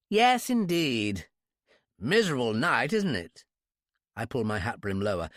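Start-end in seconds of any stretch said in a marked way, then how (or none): 0.1-3.4 affected English small talk, drawn out; exaggerated British accent. A narrator's reading. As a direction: chat airily in high-class English. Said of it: "high-class English accent".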